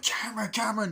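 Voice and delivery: high, loud frantic call of a name; panicked yell across the pool. Retold or imitated. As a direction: shout for him as he sinks. Friends call to Cameron in pool.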